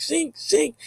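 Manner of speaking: two high ringing zings; zing it high, twice; imitated zing. Showing the apparition's sound, high zings. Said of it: "It was like".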